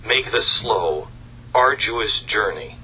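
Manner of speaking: ordinary delivery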